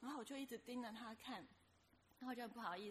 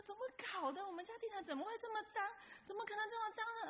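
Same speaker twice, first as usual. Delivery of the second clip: much higher, fast, astonished; shocked disbelief; 10 semitones higher, faster than usual. Retold or imitated. Narrator stunned by her own dirty carpet, high and fast. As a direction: gasp at how dirty it all is.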